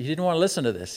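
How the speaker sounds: usual speaking voice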